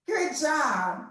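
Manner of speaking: ordinary talk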